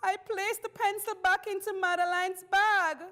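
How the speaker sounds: high, loud pleading confession; pitiful voice. A narrator's reading. Child confessing to the class about the pencil, pitiful voice. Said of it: "said in a pitiful voice".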